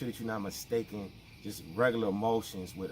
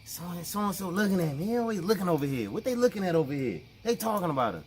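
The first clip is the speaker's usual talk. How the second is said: paranoid coworker; 5 semitones higher, 4 dB louder, faster than usual. Retold or imitated. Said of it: "that person at work that's like".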